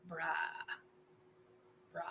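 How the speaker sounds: normal talking voice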